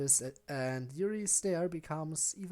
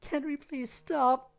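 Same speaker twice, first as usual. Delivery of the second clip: crying voice; 11 semitones higher than usual. Acted. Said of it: "a proper crying voice".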